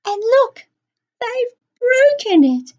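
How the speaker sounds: very high, loud tearful cub; Baby Bear, bursting into tears. A narrator's reading. Baby Bear finds his chair broken, a high tearful wail. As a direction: wail it like a little cub about to sob. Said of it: "He burst into tears".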